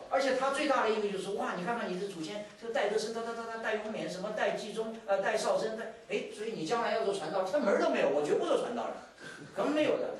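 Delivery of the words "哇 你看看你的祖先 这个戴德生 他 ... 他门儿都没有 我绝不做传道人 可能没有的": angry outburst. Retold or imitated. Pastor's son fed up with his famous ancestors, angry. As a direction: blurt it out like a resentful teenager. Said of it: "他很火".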